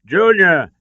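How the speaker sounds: high, loud desperate shout; father shouting to his son at the cliff edge. A narrator's reading. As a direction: yell his son's name in alarm. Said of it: "Henry shouted".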